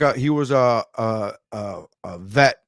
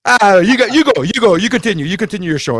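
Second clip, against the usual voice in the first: higher, louder put-on voice, timidly yielding; cowed comic; 5 semitones higher, 4 dB louder than usual. Comic handing the stage back, timid put-on voice. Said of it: "comic cowering, yielding stage".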